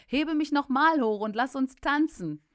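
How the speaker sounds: high, loud, exultant cry; joyful. Retold or imitated. Preacher asks the healed man to lift him again, exultant. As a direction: shout it out with delight.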